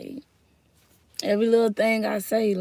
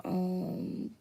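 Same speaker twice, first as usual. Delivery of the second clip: ordinary talk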